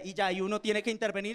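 usual speaking voice

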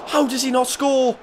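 very high, loud disbelieving cry; disbelief. Real, not acted. Manager after the striker misses, disbelieving cry.

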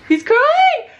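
very high excited squeal; thrilled surprise. Real, not acted. Mother seeing her baby crawl, thrilled. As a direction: squeal in real astonishment.

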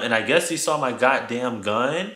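usual speaking voice

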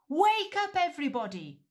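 high loud wake-up shout. Acted. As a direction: shepherds shout to wake the town. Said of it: "they shouted".

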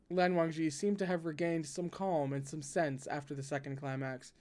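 usual speaking voice

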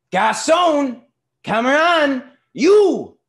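high, very loud summons, word by word; boisterous shouting. A narrator's reading. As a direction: bellow for service. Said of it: "he shouted".

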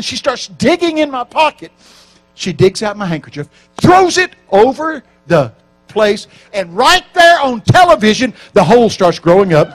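loud, rising preacher's build; preaching at full force. Acted. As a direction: shout the miracle to the crowd.

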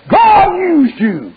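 slow, high preaching shout on "God used you"; fiery preacher proclaiming each word slowly. Acted. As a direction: drag it out and cry it high.

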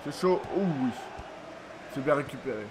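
usual speaking voice

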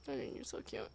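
soft, low, doting voice; tender cooing. Acted. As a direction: coo gently at a pet. Cooing to creature.

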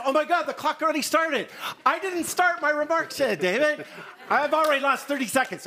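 real alarm that the clock is already running; complaining to David that his time is ticking; flustered alarm. Real, not acted. Clock started before his remarks.

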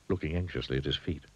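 ordinary delivery, nothing marked

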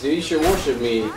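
ordinary talk, nothing marked

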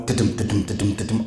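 soft rhythmic da-dum footsteps. Retold or imitated. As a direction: tap out the stairs under your breath. Imitates footsteps down stairs.

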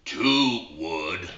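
a low, slow little frog calling out the club; talking frog. Acted. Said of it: "He hears".

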